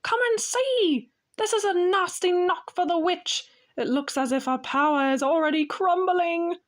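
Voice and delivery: high, gleeful beaver voice; nearly dancing with joy; delighted. A narrator's reading. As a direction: Mr Beaver showing the sledge, delighted. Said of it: "almost dancing with delight".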